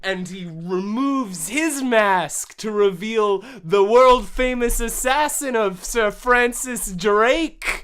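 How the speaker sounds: slow, loud, epic storyteller voice; booming slow narrator; dramatic narration. A narrator's reading. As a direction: build it like a movie-trailer reveal.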